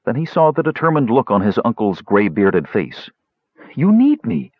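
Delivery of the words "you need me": put-on young nephew voice. A narrator's reading. Basalt voiced, pleading.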